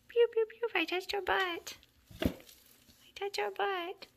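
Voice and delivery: teasing pet talk. Real, not acted. Touching the kitten's rear, high teasing pet talk. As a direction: tease a kitten in a squeaky-high voice.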